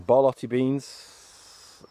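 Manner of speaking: usual speaking voice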